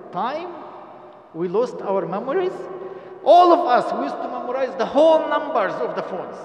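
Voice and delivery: ordinary talk, nothing marked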